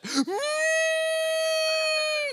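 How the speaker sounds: very high, long held comic wail; keen on one high note like hysterical crying; mock hysterical wail. Husband told to give it all, mock hysterical crying. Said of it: "I start crying hysterical".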